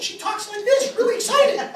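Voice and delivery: very high cartoonish squeaky voice; really excited. Stepson imitating his dad's hypervisual wife, really excited. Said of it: "She's like Bart Simpson".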